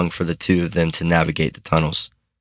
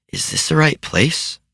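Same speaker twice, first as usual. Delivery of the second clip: lightly voiced boy; 10 semitones higher than usual. A narrator's reading. Nelson asking Mina.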